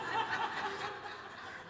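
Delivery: a high breathy laugh that breaks out mid-line; burst of laughter. Real, not acted. Pastor laughing at his own rent joke, high breathy laugh.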